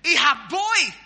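sudden loud, high call; forceful dialect command. Acted. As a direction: belt it out like an order. The command voiced in his dialect.

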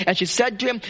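ordinary talk

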